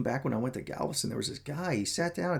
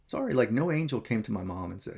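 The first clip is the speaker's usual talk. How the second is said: ordinary delivery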